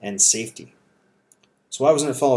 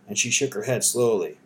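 ordinary delivery, nothing marked

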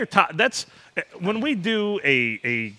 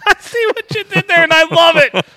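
high, loud, through real laughter; helpless high-pitched amused outburst; laughing delight; 13 semitones higher, 10 dB louder than usual. Real, not acted. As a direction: shout it out while cracking up. Cracking up at co-host's joke.

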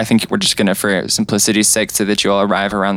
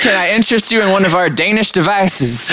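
bright, higher cashier sales pitch; upselling cashier; 7 semitones higher than usual. Acted. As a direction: pitch the item like a chipper clerk. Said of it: "and it's a".

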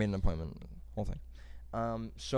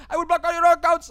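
much higher and louder shrieked threat; scammer screaming; 13 dB louder than usual. Retold or imitated. Scam caller screaming threats, mocked as very high. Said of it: "He was fucking screaming at me".

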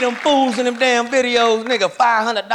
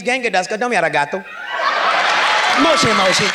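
mock Japanese; faster than usual. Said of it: "if you're Japanese".

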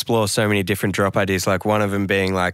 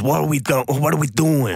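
higher, hammed-up New York accent; terrible New York accent; 4 semitones higher than usual. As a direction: put on a bad New Yorker voice. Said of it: "that's a terrible New York accent".

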